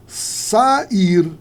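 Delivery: SAIR split as SA-IR, drawn out; syllables separated. Shown as a demonstration. Teacher showing students a hiatus, SAIR in separated syllables. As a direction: say SAIR as two separate vowels, A then I.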